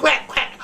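high puppet-duck quack; two high quacks. Pretend. Duck puppet answers the host.

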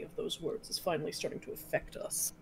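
whispering. Acted. Game line whispered.

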